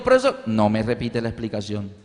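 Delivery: a hint of a wronged pupil; mild daughter complaint.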